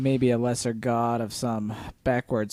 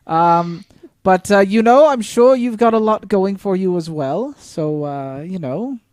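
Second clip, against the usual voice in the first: awkward, louder reassurance full of hesitations; flustered party member; 6 semitones higher, 10 dB louder than usual. Acted. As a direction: placate him with raised voice, fumbling for words.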